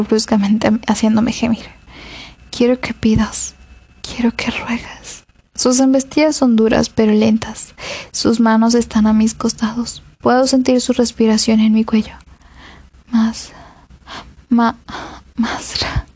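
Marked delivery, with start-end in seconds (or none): 2.5-5.2 husky whispered command; whispering. A narrator's reading. Commanding lover's whispered demand. 13.1-16.0 breathless, breaking off mid word; cut off mid word. A narrator's reading. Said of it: "me quedé a media palabra".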